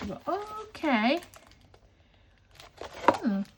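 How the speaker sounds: slow, high, drawn-out okay, then hmm; pleasantly surprised. Real, not acted. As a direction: sound impressed despite yourself. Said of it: "That's my pleasantly surprised voice".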